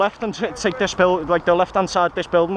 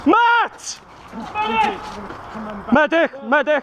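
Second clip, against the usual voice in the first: man down shout; 7 semitones higher, much slower than usual. Real, not acted. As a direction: yell for a medic, high and urgent. Man down calling for medic.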